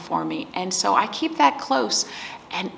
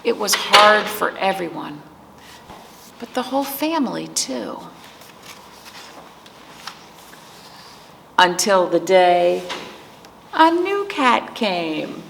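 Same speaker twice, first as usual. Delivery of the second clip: put-on reading to children. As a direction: let each page breathe for the little ones. Reads picture book to children.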